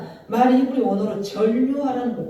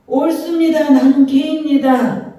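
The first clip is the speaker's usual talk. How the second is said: high, loud, slow pleading cry; desperate cry; 5 semitones higher, 7 dB louder than usual. Acted. 치욕을 받아들이며 간절히 외치는 여인. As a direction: cry out the confession, slower.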